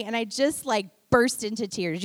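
ordinary delivery

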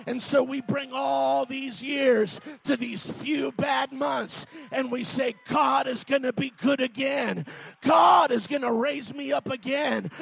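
shouted revival sermon; preaching at full force. Acted. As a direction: preach it hard to the congregation.

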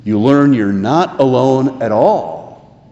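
ordinary talk

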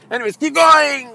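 high, calling out to the crowd; mock caravan leader. Caravan leader calls the marchers onward, high mock call. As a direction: wave the crowd on.

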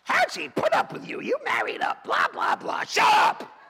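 high, loud tirade into a shouted retort; mock scolding shout. Author mimicking readers' complaints and snapping back, mock shouting. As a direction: rattle off the complaint, then blast it away.

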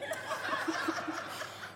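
breathy high-pitched laugh; a light unvoiced laugh; high airy laugh. Real, not acted. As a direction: giggle at your own impression. Laughs at own parent impression.

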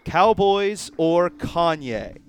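hosting the game, announcing its name slowly; game show title. Acted. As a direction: boom it like a host.

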